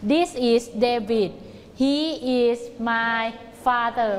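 very slow, word-by-word; slow choral drill. Shown as a demonstration. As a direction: chant it slowly together. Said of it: "พร้อมๆกันใหม่".

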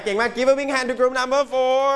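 high, bright praise for the group; teacher cheering a group's answer; excited praise. Real, not acted.

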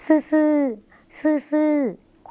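ซื่อๆ ซื่อๆ drawn out very slowly, pitched high; slow word demo. Shown as a demonstration. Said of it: "ก็จะเป็นคำว่า".

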